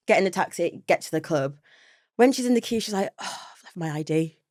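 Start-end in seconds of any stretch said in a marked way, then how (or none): none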